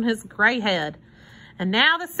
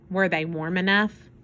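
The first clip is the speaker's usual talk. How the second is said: ordinary delivery, nothing marked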